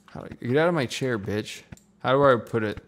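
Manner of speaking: normal talking voice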